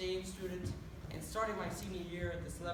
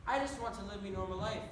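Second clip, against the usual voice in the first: "me normal life" in a broad Irish brogue, with "me" for "my"; thicker Irish accent. Acted. Broad Irish brogue, telling the audience his dream. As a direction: lean into the Irish accent, saying "me" for "my". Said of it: "speaking in an accent".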